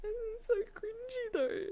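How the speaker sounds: very high, slow squeal of embarrassment; embarrassed whine. Real, not acted. Streamer cringing at talking to a cat, embarrassed high whine. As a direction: squeal it out slowly, cringing at yourself. Embarrassed after being asked to meow.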